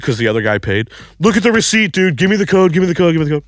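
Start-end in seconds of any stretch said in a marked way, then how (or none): 1.2-3.4 high, frantic repeated demand; urgent plea. Retold or imitated. Desperate man begging his friend for the code, frantic high plea. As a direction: hammer the request in a high, desperate rush.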